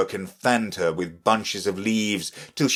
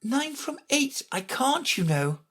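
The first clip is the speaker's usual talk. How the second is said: high, softer little-voice reply; Alice answering readily; 4 dB quieter than usual. A narrator's reading. Alice answering the Queen's sum, readily. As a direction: answer lightly in a high, small voice. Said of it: "Alice replied very readily".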